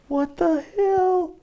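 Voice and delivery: high, slow, astonished cry of disbelief; a teen gaping at a strange new album; baffled kid. Retold or imitated. Recalling his teenage self hearing Lamb Lies Down, high and stunned.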